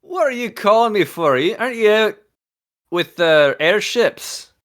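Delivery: Scottish Kagan. Acted. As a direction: boom out a delighted Scottish greeting. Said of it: "the best day to do a Scottish accent".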